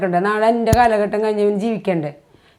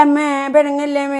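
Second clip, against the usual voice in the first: put-on higher-pitched reproachful echo of a child's plea; mocking retold plea; 5 semitones higher than usual. Mother throws son's apology back at him, mocking plea. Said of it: "പറയാനുള്ളതൊക്കെ പറഞ്ഞു കഴിഞ്ഞിട്ട്".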